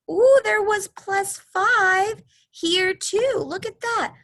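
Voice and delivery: excited. Acted. Teacher marvels at pattern.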